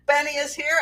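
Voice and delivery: ordinary talk, nothing marked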